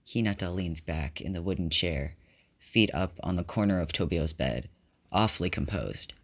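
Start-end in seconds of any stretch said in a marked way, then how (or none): none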